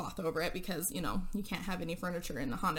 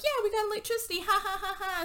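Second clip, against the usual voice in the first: high goofy ha ha ha voice; squeaky dopey fake laugh; mocking dumb laugh; 11 semitones higher, faster than usual. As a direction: play the dumb partiers cackling at the lights. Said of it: "they're all like".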